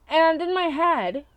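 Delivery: ordinary talk, nothing marked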